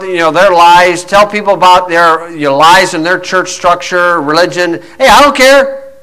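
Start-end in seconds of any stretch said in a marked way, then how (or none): 5.0-5.9 higher-pitched defiant brush-off; defiant dismissal. Acted. Preacher dismisses upset socialist liberals, defiant. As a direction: throw it back at the objectors, pitched up. Defiant retort to imagined objectors.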